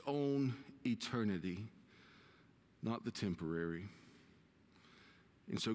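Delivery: low, hushed pulpit prayer; earnest prayer. Real, not acted. Pastor asking God to speak to the church, prayerful and low. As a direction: pray it reverently, with pauses between phrases.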